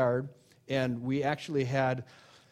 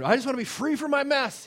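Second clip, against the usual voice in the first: much higher, loud plea; pleading believer; 11 semitones higher, 5 dB louder than usual. Retold or imitated. Believer begging God for freedom, high loud plea.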